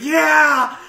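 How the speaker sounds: high, loud, mock enthusiastic yell; a playful exaggerated yes; eager shout. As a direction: blurt it out gleefully. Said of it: "It's like".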